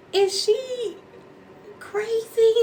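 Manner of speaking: very high, loud disbelief; incredulous squeal. Retold or imitated. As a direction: squeal it in mock bewilderment. Retold baffled reaction to crying.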